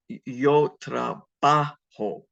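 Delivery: yo trabajo slowly, with a drawn-out strong "ba"; second-last syllable stressed. Shown as a demonstration. Teacher showing where you put the emphasis, stressing trabajo's "ba". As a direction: lean hard on "ba" in trabajo. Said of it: "it goes on the second last syllable".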